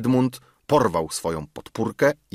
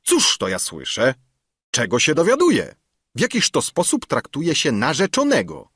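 raised, high-pitched father's scolding; indignant calling out; 8 semitones higher, 4 dB louder than usual. A narrator's reading. Councillor confronts his daughter about her fiancé, indignant. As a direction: demand answers, pitch leaping. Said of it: "zawołał do córki".

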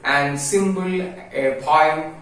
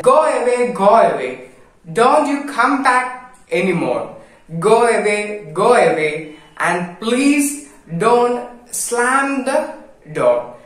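raised, higher pleading shout of go away; frightened command; 6 semitones higher, 3 dB louder than usual. Acted. Frightened man ordering the ghost to go away, raised and pleading. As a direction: order the ghost out, half scared.

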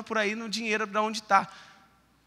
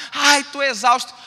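mock exhausted; 6 semitones higher, 10 dB louder, slower than usual. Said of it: "você fala assim".

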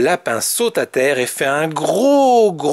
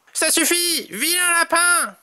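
shouting Tom; 11 semitones higher than usual. A narrator's reading. Tom scolds the naughty rabbit, high mock shout. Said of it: "Crie Tom".

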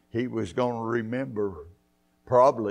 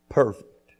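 usual speaking voice

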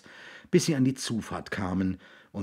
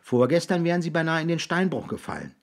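put-on higher-pitched voice of a boy; earnest boy; 8 semitones higher, faster than usual. Jix explains to William why they watch him, earnest boy.

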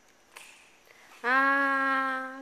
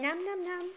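put-on playful chewing sounds for baby; nom nom chomping; 5 semitones higher, 4 dB quieter, faster than usual. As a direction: chomp it out to coax a bite. Modelling eating for baby.

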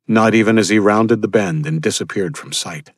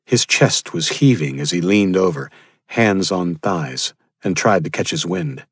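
ordinary talk, nothing marked

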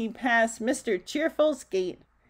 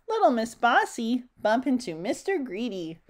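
plain reading voice

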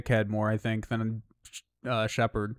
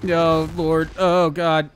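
excited; 3 semitones higher, 5 dB louder, much slower than usual. Real, not acted. Tense moment in the game.

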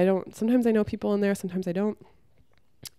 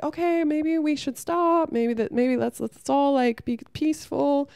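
timid peacemaker; 8 semitones higher than usual. Retold or imitated. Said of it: "the Ken guy was like".

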